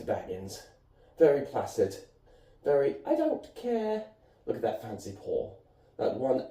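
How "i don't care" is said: high, slow mock cat voice; cat's indifferent voice. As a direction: draw it out high as an uncaring cat. Voices the placid cat's thought.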